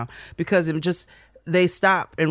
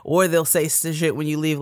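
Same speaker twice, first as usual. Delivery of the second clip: usual speaking voice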